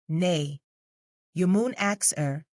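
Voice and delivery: a light broad Derbyshire brush-off; callous broad dialect. Acted. Keeper brushing off the lady in broad Derbyshire, callous. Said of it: "he replied callously, in broad vernacular".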